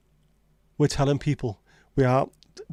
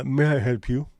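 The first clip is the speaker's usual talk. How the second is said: posh phone voice. Shown as a demonstration. Poshing it up for the telephone, playing a prim receptionist. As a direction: put on a prim, polite telephone voice. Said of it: "This is my phone voice".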